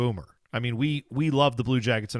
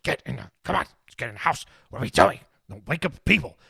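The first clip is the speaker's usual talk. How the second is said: whisper yelling. Retold or imitated. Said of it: "whisper shouting at him".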